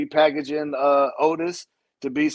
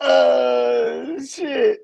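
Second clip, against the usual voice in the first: high shocked yell into laughter; shocked shout; 9 semitones higher than usual. Real, not acted. Shocked reaction, cry, laugh.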